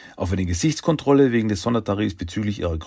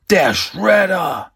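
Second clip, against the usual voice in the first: drawn-out high, loud cry; alarmed shout; 11 semitones higher, 7 dB louder, much slower than usual. Acted. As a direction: shout the villain's name slowly in horror. Entsetzter Ausruf beim Erkennen. (entsetzter, gedehnter Schrei)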